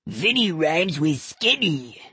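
slow puppet voice lightly put on; a hint of a dummy's voice. Johnny making the dummy tease Vinny, light puppet voice. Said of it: "he made the dummy say".

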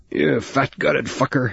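slow, strained groan through pain. Acted. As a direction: groan it out, pinned in a headlock. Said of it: "he groaned".

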